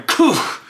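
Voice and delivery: high winded grunt of a landing; a dwarf hitting the bottom hard; thud grunt. Acted. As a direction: oof as he lands. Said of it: "and you just hear him".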